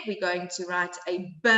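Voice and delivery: normal talking voice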